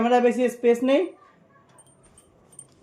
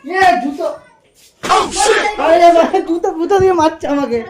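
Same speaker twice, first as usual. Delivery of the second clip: yelling across the room; 6 semitones higher than usual. Real, not acted. Being hit with a shoe.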